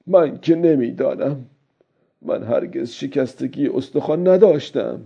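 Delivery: high-pitched character voice, musing; old fisherman talking to himself. A narrator's reading.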